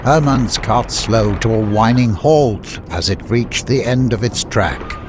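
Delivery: ordinary delivery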